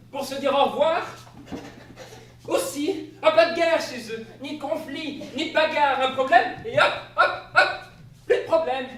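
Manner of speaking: high, loud, laughing retelling; amused excitement. A narrator's reading. Narrator describing bonobos' habits, giggly and excited. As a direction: gush about it, half laughing.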